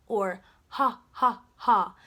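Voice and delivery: ha ha like a laugh. Shown as a demonstration. Teacher showing students the laughing H sound. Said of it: "we call this the laughing sound".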